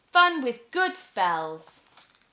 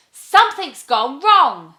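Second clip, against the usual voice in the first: loud, alarmed cry; dismayed witch; 5 semitones higher, 13 dB louder than usual. Acted. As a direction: blurt out in dismay. Witch dismayed at failed spell.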